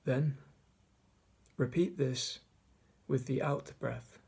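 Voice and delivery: measured meditation guide, long pauses between phrases; guided meditation. Acted. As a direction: guide listeners through the breath, leaving space after each phrase. Leads a mindfulness meditation.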